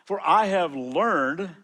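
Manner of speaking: "I have learned" drawn out slow, each word weighted; slow stressed reading. Shown as a demonstration. Pastor reading Philippians to the congregation, slow and stressed. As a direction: lean hard on every word of "I have learned".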